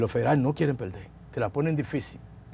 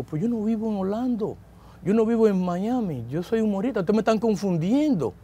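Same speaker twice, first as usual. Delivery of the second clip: higher-pitched, mildly pleading protest; retold protest; 6 semitones higher than usual. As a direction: insist they have the wrong man. Retold protest of innocence to lawyer.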